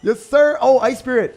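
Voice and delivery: very high, loud celebration; hyped gamer cheer; excited shout. Real, not acted. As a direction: yell in triumph at the game. Celebrating a tower hit.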